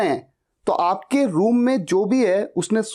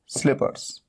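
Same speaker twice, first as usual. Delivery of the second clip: Slippers whispered, soft and quick; whispered answer; 6 semitones lower, 4 dB quieter than usual. Shown as a demonstration. Teacher whispers the right word to students. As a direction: breathe slippers out as a prompt. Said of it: "इसे क्या बोलना है?".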